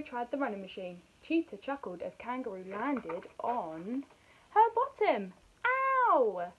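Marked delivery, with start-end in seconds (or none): 4.5-5.3 high, loud punchline; comic reveal. A narrator's reading. Narrator revealing where Kangaroo landed, comic exclamation. 5.7-6.5 high, loud, drawn-out "ow"; cartoon yelp of pain. A narrator's reading. Kangaroo landing on her bottom, loud ow.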